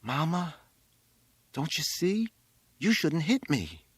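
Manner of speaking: high, thin, tearful plea; thin, crying. A narrator's reading. Exhausted boy on the roof to his mother, thin tearful voice. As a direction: plead thinly, worn out from crying. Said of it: "it was thin and crying".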